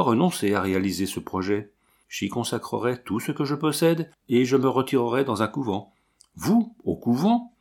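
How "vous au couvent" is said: high, slow and pained disbelief; a man aghast at her choice, pained and slowed; pained cry. A narrator's reading. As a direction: cry it out in sorrowful shock. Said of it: "S'écria douloureusement Hervé".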